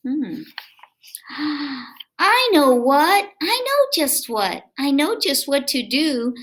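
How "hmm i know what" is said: usual speaking voice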